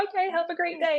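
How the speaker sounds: high, sugary-sweet sign-off; fake cheerful. Makeup artist brightly brushing off rude client, fake cheerful. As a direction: chirp it brightly as if nothing happened. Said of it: "And I was like".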